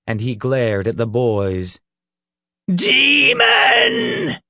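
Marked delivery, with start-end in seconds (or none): 2.7-4.4 very high, loud scream of wrath; wrathful shriek. A narrator's reading. Reverend glares at the boys, shrieking with wrath. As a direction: shriek it in fury, stretched out. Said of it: "he shrieked, his voice filled with wrath".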